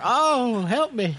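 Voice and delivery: high hammed-up cry for help; fluttering cries for help. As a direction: fake a dive, whimper for help. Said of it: "The, the freaking soccer players".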